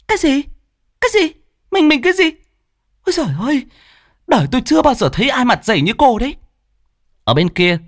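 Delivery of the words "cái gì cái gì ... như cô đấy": very high, indignant screech; furious shriek. A narrator's reading. Jealous woman scolding her rival, shrieking. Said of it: "như rít lên".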